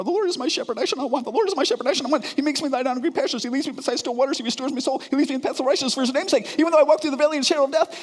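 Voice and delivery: high, rushing panicked recitation; patient reciting Psalm 23 in panic, high and rushing; frantic psalm reciting. Retold or imitated. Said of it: "I start quoting Psalm twenty-three".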